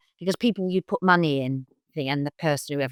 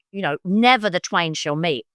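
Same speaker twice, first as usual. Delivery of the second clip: ordinary talk, nothing marked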